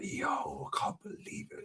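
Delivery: whispered imitation of an amazed friend; awed whisper. Friend in the club marvels at the women, awed whisper. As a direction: whisper it as if secretly awestruck.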